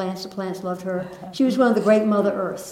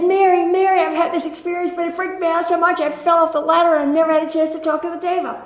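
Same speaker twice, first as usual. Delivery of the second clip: imitating her high, fast, rushed complaint; flustered friend; 9 semitones higher, 6 dB louder, faster than usual. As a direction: pitch it up and rush it like a shaken friend. Friend's flustered story voiced.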